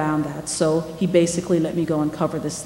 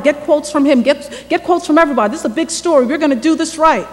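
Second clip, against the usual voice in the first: higher and louder rapid-fire orders; excited chief; 6 semitones higher, 4 dB louder than usual. Retold or imitated. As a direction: fire her up with eager commands. Editor-in-chief enthused, giving orders.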